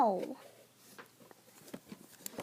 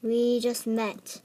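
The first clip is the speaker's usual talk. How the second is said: ordinary delivery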